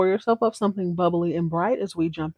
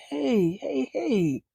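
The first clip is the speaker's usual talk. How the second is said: higher-pitched, lightly voiced soothing heys; placating lawyer; 6 semitones higher, 4 dB quieter, much slower than usual. Lawyer stalling an old friend's divorce, mildly placating. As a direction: gently try to slow her down.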